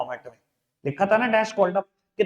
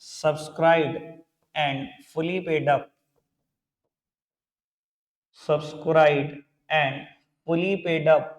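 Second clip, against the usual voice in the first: drawn-out words for note-takers; measured, word-by-word heading; slow dictation; slower than usual. Real, not acted. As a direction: dictate slowly, pausing between phrases. Teacher dictates a heading, repeats it.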